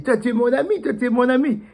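overjoyed little mouse; grateful joy. Acted. Freed mouse thanks rescuer.

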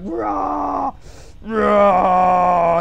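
mock roar, drawn out; imitated roar. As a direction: silly caricature roar. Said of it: "He's like".

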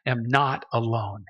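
subdued, heartfelt confiding in Jesus; sincere humble prayer. Acted. Suffering believer thanking Jesus as a friend, humbly sincere. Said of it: "come to God and say".

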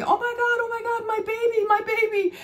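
very high, frantic wail at normal volume; mock panicked screaming. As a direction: act out a distraught dog owner. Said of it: "I would have been screaming".

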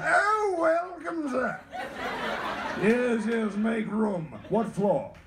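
put-on high, grand old British mayor welcoming guests; hammed-up British old-timer playing genteel host; old British gentleman. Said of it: "that kid sounded like an old British guy".

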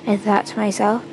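ordinary delivery, nothing marked

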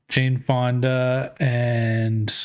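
slow and halting, searching for a name; slow hesitant. Real, not acted. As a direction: drag it out while trying to remember. Stalls trying to recall name.

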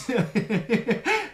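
high rising chuckle; a bloke laughing at his own pub gag; laughing at own joke. Real, not acted. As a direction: crack up at your own punchline.

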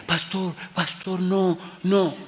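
high, anxious woman's voice; an alarmed lady warning the pastor; woman pleading. Retold or imitated. Lady urgently warns pastor away from sick son, high pleading. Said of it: "Y dice la señora".

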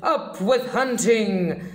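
mock Tyrannosaurus war cry; shouted. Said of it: "And they shouted".

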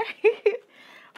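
high, loud giggle. Real, not acted. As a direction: giggle at your own sass. Laugh after sass.

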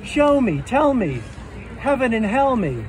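normal talking voice

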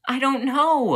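loud, very high cry of frustration; exasperated outburst. Acted. Student blamed either way, loud frustrated outburst. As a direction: burst out at full pitch, overwhelmed.

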